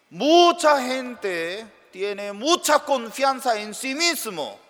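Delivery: high, loud preaching; preaching at full force. Acted. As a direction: drive it home to the congregation. Predicación enfática. (predicando con fuerza)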